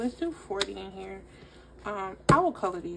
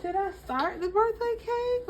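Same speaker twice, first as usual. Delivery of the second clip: high-pitched; 13 semitones higher, 3 dB louder than usual. Real, not acted. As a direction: question asked much higher. Repeats the question to viewers.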